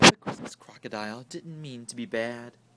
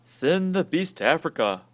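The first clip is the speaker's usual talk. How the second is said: loud, blustering huff; gruff uncle's loud huff; 5 semitones higher, 8 dB louder than usual. Acted. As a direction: bluster like a grumpy old uncle. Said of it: "huffed Uncle Theodore".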